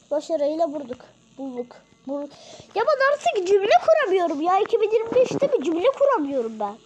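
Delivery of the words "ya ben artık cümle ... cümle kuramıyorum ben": loud, high exasperated rant at himself; shouting at himself over mangled sentences; frustrated shouting. Real, not acted. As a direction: yell it out, fed up with his own words. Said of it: "bağırıyorum ya hani".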